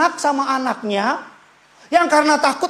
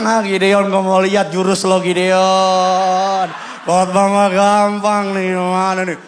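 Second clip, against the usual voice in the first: teasing onlookers; 2 semitones lower, slower than usual. Retold or imitated. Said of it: "Kan mereka bilang".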